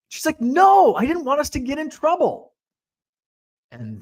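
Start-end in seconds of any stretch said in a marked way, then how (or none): 0.4-2.5 retorting cousin. Retold or imitated. Cousin snapping back at narrator.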